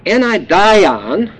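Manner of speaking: anidion said very slowly, syllable by syllable; Greek word. Shown as a demonstration. Preacher teaches the congregation the Greek word, slow. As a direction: spell out anidion carefully. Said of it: "the Greek word".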